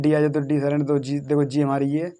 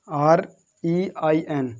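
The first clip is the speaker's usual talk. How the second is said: slow spelling; much slower than usual. Shown as a demonstration. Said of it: "रैन".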